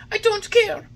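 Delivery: put-on high, loud whiny boy voice; stubborn puppet. A narrator's reading. Puppet refusing the fairy's medicine. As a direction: refuse like a spoilt child.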